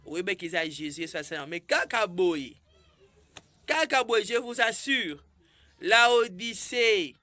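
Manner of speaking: full-force sermon build; fiery preaching. Acted. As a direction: preach it out to the congregation.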